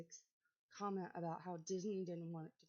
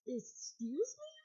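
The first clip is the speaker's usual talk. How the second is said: shocked disbelief; 6 semitones higher, 3 dB louder, slower than usual. Real, not acted. Reacting to a shocking claim.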